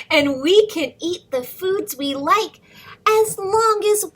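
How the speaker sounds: put-on lively read-aloud for little kids; storybook reading. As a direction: read the rhyme to children, bouncing along. Reads picture book to kids.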